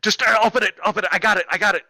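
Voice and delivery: high, loud, frantic command; high-pitched mock-dramatic shouting; urgent yell. Acts out urgent yell in story.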